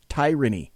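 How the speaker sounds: "tyranny" said as TIE-ranny; mispronounced tyranny. Retold or imitated. Host quotes the voice actor's mistake, "tyranny" mispronounced as TIE-ranny. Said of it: "he mispronounces the word, he says it".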